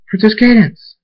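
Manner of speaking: high, loud searching call; screamed name. A narrator's reading. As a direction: scream out for the princess. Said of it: "Thunderstorm screams".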